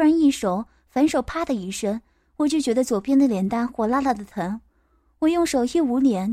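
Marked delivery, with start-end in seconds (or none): none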